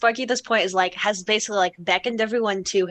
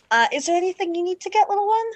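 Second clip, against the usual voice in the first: high, lilting question; talking to a child; 9 semitones higher, faster than usual. Acted. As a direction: ask a little one kindly, pitched right up. Sparky asks the small child.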